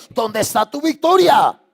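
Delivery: slow, loud, high cry of challenge; challenging shout. Acted. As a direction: hurl the question at the church. Preacher's rhetorical challenge.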